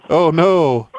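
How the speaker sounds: a hint of a squeaky scared man; lightly voiced mock fear. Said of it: "says the man with the squeaky voice".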